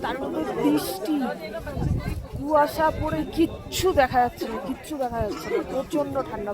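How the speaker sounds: calling out in the cold; loud. Real, not acted. Calling out in rain on the mountain.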